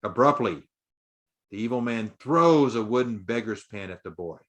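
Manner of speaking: ordinary delivery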